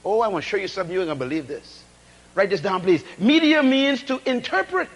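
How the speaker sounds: ordinary talk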